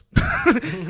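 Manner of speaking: a loud burst of real laughter; sudden laugh. Real, not acted. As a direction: laugh out loud, caught off guard. Laughs at dad's blunt verdict.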